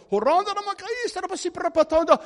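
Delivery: high, loud mimicked burst of tongues; imitated tongues. Preacher imitating office staff shaking on the floor, high loud tongues. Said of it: "shaking on the floor like".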